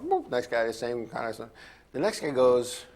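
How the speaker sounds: ordinary talk, nothing marked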